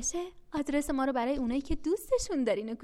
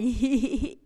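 sly chuckle; 5 dB louder than usual. Acted. Bag laughs after trick.

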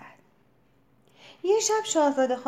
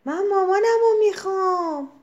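very high, loud yell; full tantrum yell for mommy; loud shout; 8 semitones higher, 7 dB louder than usual. A narrator's reading. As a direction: shout it at the top of her lungs. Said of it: "شاهزاده خانم فریاد زد".

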